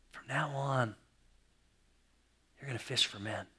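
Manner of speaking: soft, hushed and gentle; tender reassurance. Retold or imitated. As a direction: comfort a frightened friend quietly. Jesus comforts Simon tenderly.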